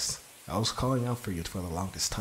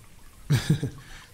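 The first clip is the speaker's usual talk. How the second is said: a higher playful chuckle; teasing laugh; 6 semitones higher, 4 dB louder than usual. Acted. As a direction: laugh at them knowingly. Teasing partner about staring.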